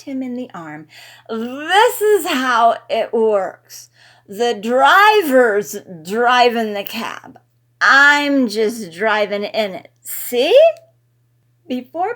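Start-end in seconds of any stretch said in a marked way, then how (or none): 1.3-10.6 loud, slow tipsy teasing; drunken teasing. A narrator's reading. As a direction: tease him, slow and drunk. Drunk Riley explains.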